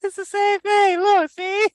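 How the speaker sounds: mock seller voice. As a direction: pitch it high like a shady seller insisting. Mocking the knockoff seller.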